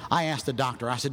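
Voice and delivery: ordinary talk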